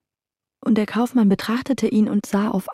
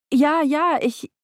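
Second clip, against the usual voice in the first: higher, hurried nervous yes; anxious merchant; 8 semitones higher than usual. Acted. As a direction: blurt it out in panic.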